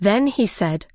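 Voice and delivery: usual speaking voice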